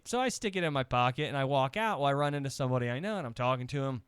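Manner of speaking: normal talking voice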